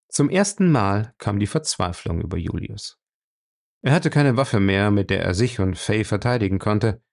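plain reading voice

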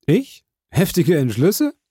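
hoch und ungläubig aufgefahren; empörte Verwunderung. A narrator's reading. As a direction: die Unterstellung entrüstet zurückweisen. Morell empört abwehrend. (Morell fährt den Grafen ungläubig an, hoch und empört)

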